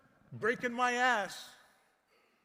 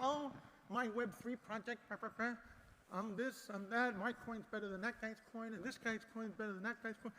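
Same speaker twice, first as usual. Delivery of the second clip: mock bickering; 10 dB quieter than usual. Mocking bickering crypto projects.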